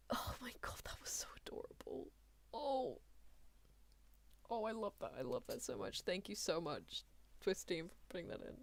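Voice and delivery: very soft, almost whispered thanks; moved, hushed gratitude. Real, not acted. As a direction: murmur it, quietly overcome. Touched, thanking the show's team.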